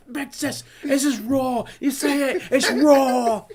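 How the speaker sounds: high-pitched chef complaint; strained, outraged imitation of a chef; mock wigging out. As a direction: rail at the undercooked food. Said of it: "he wigs out on him".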